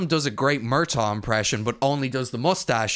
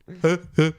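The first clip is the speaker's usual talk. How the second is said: honking, huffed staccato laugh; mimicked comedian's signature laugh; Eddie Murphy; 5 semitones higher, 3 dB louder than usual. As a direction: laugh in repeated throaty huffs. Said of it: "That's my Eddie Murphy laugh".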